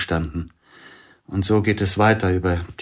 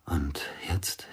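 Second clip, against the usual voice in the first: whisper; 4 semitones lower, 11 dB quieter than usual. Acted. Intimate whispered confession scene.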